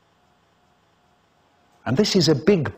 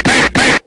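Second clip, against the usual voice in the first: loud bang bang; 8 dB louder than usual. Acted. Gunshot sound effect.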